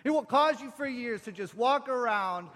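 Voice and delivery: high, loud, emphatic denunciation; preaching. Acted. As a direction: preach it forcefully against oppression. Preaches at full force.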